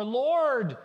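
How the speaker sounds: loud, high cry out to God; frustrated cry. Acted. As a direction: call out in pent-up frustration. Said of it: "you can hear the frustration in David's voice".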